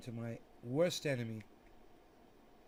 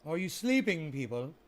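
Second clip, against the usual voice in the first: calling out; 3 semitones higher, 7 dB louder than usual. Acted. Calling to a silent chat, loud call. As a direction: call out to rouse the room.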